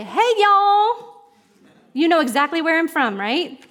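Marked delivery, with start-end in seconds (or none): 0.0-1.2 high, drawn-up Southern greeting; Southern accent. Shown as a demonstration. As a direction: call out a friendly hello in a Southern accent. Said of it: "In the South somewhere".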